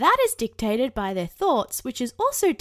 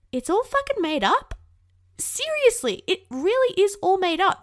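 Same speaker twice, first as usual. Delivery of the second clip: much higher and louder, insisting; emphatic insistence; 10 semitones higher, 5 dB louder than usual. Real, not acted. Telling listeners all judgement is made up, emphatic. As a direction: push it out with full conviction. Emphatic conclusion of her rant.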